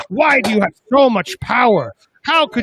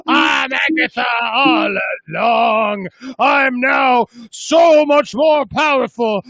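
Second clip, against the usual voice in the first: villain reveal; slower than usual. Pretend. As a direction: announce yourself with menace.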